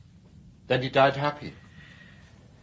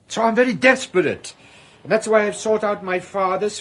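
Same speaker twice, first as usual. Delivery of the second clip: desperate plea; 6 semitones higher, 5 dB louder than usual. Acted. Fugitive pleading for help.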